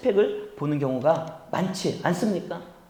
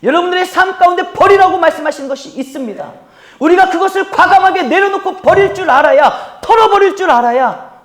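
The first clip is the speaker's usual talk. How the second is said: high, loud, driving pulpit delivery; preaching exhortation; 8 semitones higher, 10 dB louder than usual. Real, not acted. Preacher urging congregation to let go, forceful exhortation.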